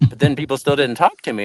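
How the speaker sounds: usual speaking voice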